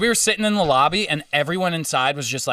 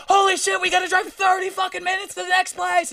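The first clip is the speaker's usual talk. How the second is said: mock panic, much higher voice; imitating a frantic friend, pitched way up; panicked girl. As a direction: squeal it like she's losing it. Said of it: "she was like".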